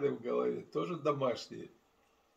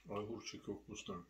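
chewing while talking; 3 semitones lower, 11 dB quieter than usual. Real, not acted. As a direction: mouth partly full. Seen 1: Bit pickle at -7; mouth working, eyes closed while speaking.

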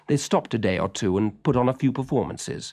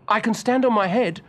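put-on much higher, bright boy's voice; eager young boy; 12 semitones higher, 5 dB louder than usual. A narrator's reading. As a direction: voice Willie as a keen little boy. Willie answering proudly.

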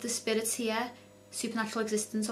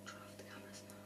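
nervous night vlog whisper; 21 dB quieter, faster than usual. Real, not acted. Whispered prompt at board.

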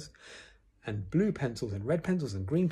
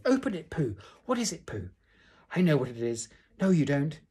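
high, eager overlapping-style calls; eager chattering crowd; 6 semitones higher, 5 dB louder than usual. A narrator's reading. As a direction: jump from one impatient friend to another. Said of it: "they were all saying".

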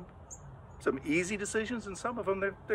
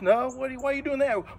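higher, surprised parent asking why; a mildly scolding parent's why; a hint of a parent scolding a child; 7 semitones higher than usual. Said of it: "your mom and dad says".

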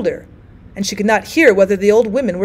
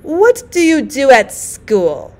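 put-on slow old woman voice; 5 semitones higher, 3 dB louder, much slower than usual. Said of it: "she asked".

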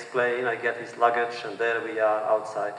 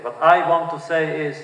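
a toast that cracks and breaks off; trying to speak through tears; voice breaking; 4 semitones higher, 7 dB louder than usual. A narrator's reading. Man at a welcome party, voice breaking mid-toast. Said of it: "once more his voice breaks".

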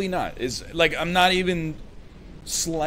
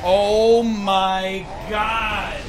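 long, stretched cry, pitched up; exasperated cry; 4 semitones higher, 4 dB louder, much slower than usual. Real, not acted. Streamer hit by the game, exasperated cry. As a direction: drag out each word in disbelief.

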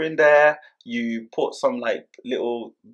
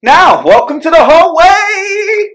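big, high, drawn-out tour welcome; hammed-up announcer shout; 13 dB louder, much slower than usual. Host presenting the hallway to viewers, loud showy announcement. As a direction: announce it loudly, stretching the last word.

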